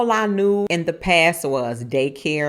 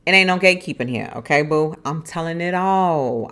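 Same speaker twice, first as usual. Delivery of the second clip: usual speaking voice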